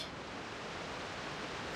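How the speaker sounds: long, very soft tired sigh; a quiet defeated exhale; weary sigh. Acted. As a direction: let the shame out in a sigh. Admits she is ashamed.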